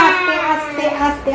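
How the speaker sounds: calm him down with a soft hush; hushing softly. Real, not acted. Mother hushing her shouting son, soft and quiet. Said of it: "এত জোর চিৎকার না মামা".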